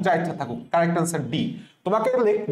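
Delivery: ordinary delivery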